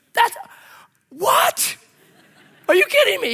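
a cracking, incredulous what; stunned disbelief. Retold or imitated. Retelling his shock at the inspector, laughing disbelief.

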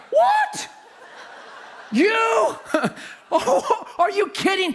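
very high squeal of shock; hammed-up high-pitched incredulity; flabbergasted disbelief. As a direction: squeak it out in mock astonishment. Stunned by the surgeon reveal.

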